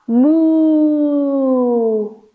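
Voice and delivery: long playful moo; cow moo. Shown as a demonstration. Teacher moos again for cow pose, playful. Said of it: "One more time in cow".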